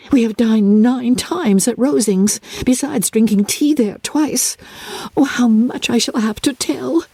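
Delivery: breathless with amazement at it all; young Maria marvelling in the carriage; girlish wonder. A narrator's reading. Maria boasting of visits.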